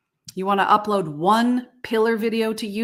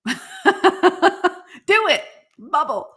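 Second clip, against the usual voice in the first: laughing bubble; 9 semitones higher, 3 dB louder, much slower than usual. Shown as a demonstration. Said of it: "you cannot be mad and say the word bubble".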